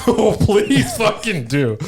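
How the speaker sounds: high, slow and delighted, laughing; excited laugh. Real, not acted. Daring his friend to make the album, laughing and high. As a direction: egg him on with a laughing, drawn-out plea.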